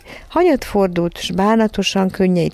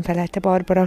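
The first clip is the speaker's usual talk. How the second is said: ordinary delivery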